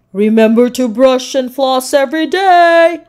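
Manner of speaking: loud calling reminder. A narrator's reading. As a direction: call after the swimming team. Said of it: "Doctor Dribble called".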